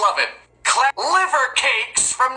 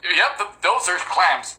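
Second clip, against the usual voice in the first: usual speaking voice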